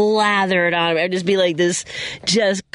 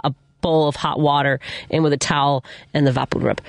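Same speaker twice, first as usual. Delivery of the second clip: usual speaking voice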